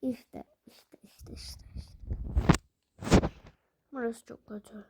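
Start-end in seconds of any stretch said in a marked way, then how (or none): 0.0-2.2 hushed whisper, holding breath. Real, not acted. Gamer to viewers while sneaking, whispering. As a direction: whisper it so the enemies won't hear. Tense moment sneaking past enemies.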